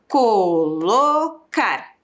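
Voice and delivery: co-lo-car bem devagar, sílaba por sílaba; separando as sílabas. Shown as a demonstration. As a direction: estique colocar marcando cada sílaba. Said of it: "Quantas sílabas?". (colocar dito bem lento, sílaba a sílaba)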